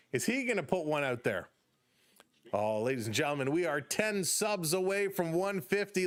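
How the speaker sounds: lifted, brisk ballgame call; play-by-play call. Acted. Streamer calling the Blue Jays game for chat, upbeat play-by-play. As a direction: call the innings like a radio announcer.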